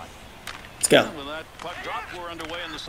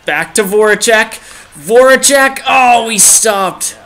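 building from lively to a high, loud call; excited hockey game commentary; excited play-by-play; 5 semitones higher, 3 dB louder than usual. Real, not acted. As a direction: call the rush with mounting excitement, higher on the last name. Live call of a scoring chance.

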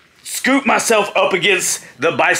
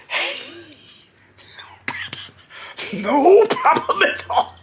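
airless whisper straining up into a high squeak; unable to breathe; 13 semitones higher, 3 dB quieter, slower than usual. Retold or imitated. Winded wrestler answering Steiner's praise, acted breathless croak. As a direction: force the words out with empty lungs. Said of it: "I still couldn't breathe".

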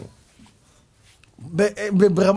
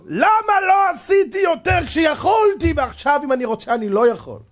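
anguished cry; 12 semitones higher, 4 dB louder than usual. Acted. Dead soul crying to the congregation, anguished high wail.

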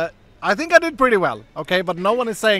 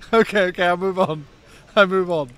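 ordinary talk, nothing marked

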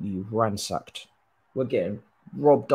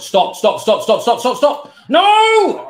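rising, louder stops ending in a high No; panicked pleading; 11 semitones higher, 13 dB louder than usual. Real, not acted. Gamer pleading as the rival attacks, panicked rising shouts. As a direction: beg the shot not to go in. Watching opponent attack on goal.

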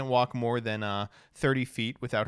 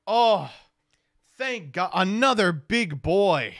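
higher, louder drawn-out exclamation; exaggerated relief; 6 semitones higher, 5 dB louder, slower than usual. Tall tourist spotting another big boy, hugely relieved. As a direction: cry it out in overjoyed relief.

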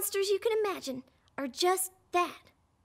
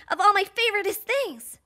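ordinary talk

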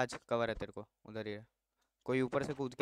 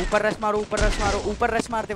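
loud, raised, repeated command; urgent shouted order; 6 semitones higher, 8 dB louder than usual. Real, not acted. Player ordering squad to rush upstairs, urgent shout. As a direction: drive the squad on loudly.

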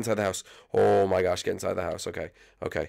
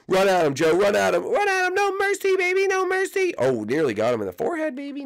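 high cheering; 4 dB louder than usual. Acted. Cheering Joe high-pitched.

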